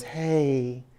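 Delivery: usual speaking voice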